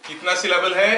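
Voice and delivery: ordinary talk, nothing marked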